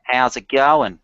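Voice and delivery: nasal Aussie greeting, talking through the nose; nasal Australian. Shown as a demonstration. Coaching a learner in the accent, nasal Australian. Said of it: "The secret is to make it really nasal".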